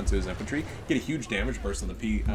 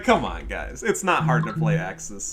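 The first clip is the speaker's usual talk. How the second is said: ordinary talk, nothing marked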